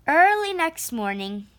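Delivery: usual speaking voice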